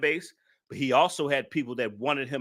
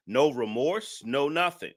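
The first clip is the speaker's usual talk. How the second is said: normal talking voice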